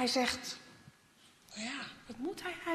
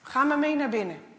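stern man's voice; 7 dB louder than usual. Acted. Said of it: "zegt de stem".

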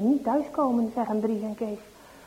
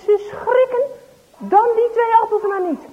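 much higher and louder, alarmed; startled fright; 9 semitones higher, 6 dB louder than usual. Acted. Boys startled by the farmer, panicking. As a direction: jump with fright.

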